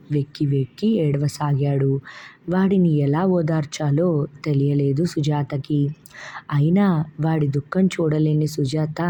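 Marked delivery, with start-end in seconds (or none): none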